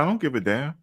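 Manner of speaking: a sudden high-pitched coach snap; mock angry coach. Coach telling benched player he doesn't care, high and sharp. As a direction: fire it back like a fed-up coach.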